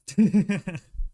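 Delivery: a loud, high burst of laughter; real loud laugh at the redeem. Real, not acted. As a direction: crack up loudly.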